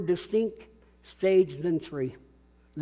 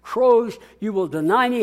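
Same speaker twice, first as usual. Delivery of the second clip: Jesus foretelling; 4 semitones higher, 6 dB louder than usual. Acted. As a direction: proclaim it like a prophecy. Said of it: "how he had said to him".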